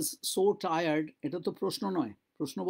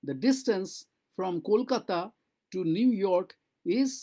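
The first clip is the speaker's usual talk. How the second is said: ordinary delivery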